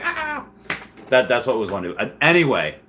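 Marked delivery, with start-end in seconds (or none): 0.0-0.5 put-on Bob's Burgers girl scream. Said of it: "she's like".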